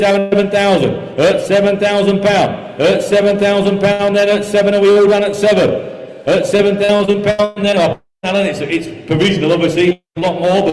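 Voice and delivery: usual speaking voice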